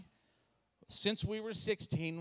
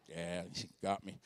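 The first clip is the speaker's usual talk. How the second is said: sheepish customer; 12 semitones lower, 6 dB quieter than usual. Retold or imitated. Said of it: "You're like".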